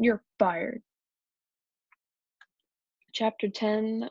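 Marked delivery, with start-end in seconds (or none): 0.0-0.8 mildly stern dismissal; storybook boss firing someone; angry shout. A narrator's reading. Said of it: "He looked really mad".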